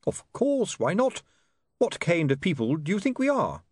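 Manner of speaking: high, affected host voice, overly hospitable; a beggar putting on gentlemanly airs; genteel duckman. Acted. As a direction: play the fussy riverside host offering tea. Duckman offering tea.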